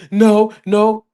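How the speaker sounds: mock tearful child's denial; crying child. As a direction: answer through tears like the son. Crying son answers dad.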